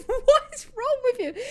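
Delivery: high, laughing question; giggling exasperation; laughing disbelief. Real, not acted. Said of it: "But, like".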